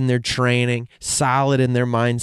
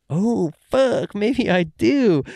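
mock realization; 8 semitones higher, much slower than usual. Real, not acted. Sudden realization.

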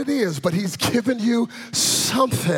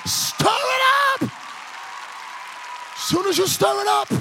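high, shouted revival preaching; fervent pulpit cry, pitched high; full-force preaching; 9 semitones higher than usual. Real, not acted. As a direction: build to a rousing shout and rally the congregation.

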